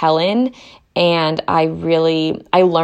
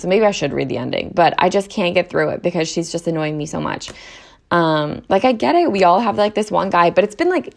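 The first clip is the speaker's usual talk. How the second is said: ordinary talk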